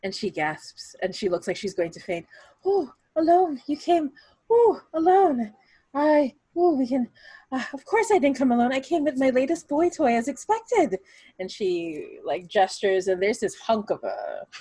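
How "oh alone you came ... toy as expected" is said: high, loud, flustered aunt babbling in a panic; a shocked aunt blurting and stammering, near fainting; aunt gasping in high alarm, trying to save face. Acted.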